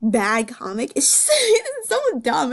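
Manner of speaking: high, laughing at the dumb comic; laughing disbelief. Real, not acted. Reader laughs at a dramatic comic, giggling. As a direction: giggle through the words in disbelief. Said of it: "this is actually me right now".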